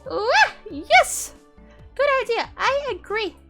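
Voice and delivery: high loud cheering; excited girl voice. Acted. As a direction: squeal with delight.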